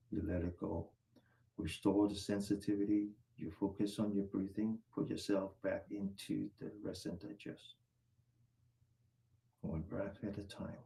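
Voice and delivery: calming breath guidance. Acted. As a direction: ease them down into calm breathing.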